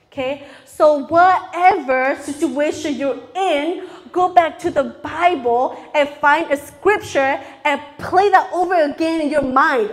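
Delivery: normal talking voice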